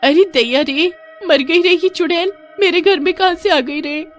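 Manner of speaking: terrified scream. Acted. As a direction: scream in terror at the ghost. Sister sees a witch at home. (डर के मारे ऊँची चीख़)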